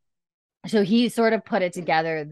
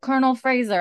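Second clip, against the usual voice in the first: calling out; 7 semitones higher, 6 dB louder than usual. Retold or imitated. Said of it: "Tryon sees him and he's like".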